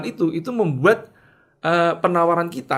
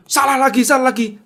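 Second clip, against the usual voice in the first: loud, high exasperated outburst; customer's scolding; 9 semitones higher, 8 dB louder than usual. Retold or imitated. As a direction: scold sharply as an angry customer. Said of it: "Saya yang dimaki juga". (omelan marah bernada tinggi)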